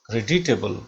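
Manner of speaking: ordinary delivery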